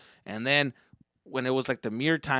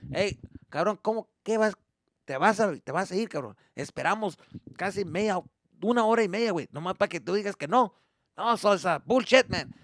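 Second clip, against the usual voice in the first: higher-pitched scolding in Spanish; exasperated dad; 6 semitones higher than usual. Acted. Dad to son who won't ride, acted exasperated scolding. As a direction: argue with your kid, exasperated.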